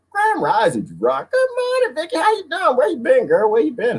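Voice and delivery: high, bright-pitched hellos to viewers; squealing good-morning shout-outs; sing-song greeting. Real, not acted.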